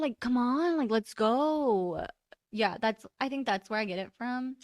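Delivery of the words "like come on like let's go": impatient whining. Retold or imitated. Kid begging mom to leave.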